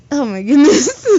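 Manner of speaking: high, loud real laughing outburst; cracking up mid-read; real laughter bursting out; breaking down laughing. Real, not acted. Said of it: "I'm sorry to laugh".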